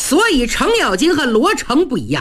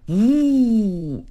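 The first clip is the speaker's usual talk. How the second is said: a swishing "呜" like a mace cutting air; whooshing swing sound; weapon whoosh. Acted. Narrator imitates weapon whooshing.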